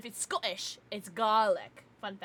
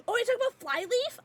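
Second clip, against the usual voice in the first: excited question; 12 semitones higher, 5 dB louder, faster than usual. Real, not acted. Excited recognition.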